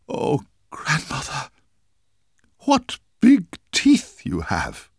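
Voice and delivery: put-on a girl crying out at the teeth; terrified girl. Girl sees wolf's teeth.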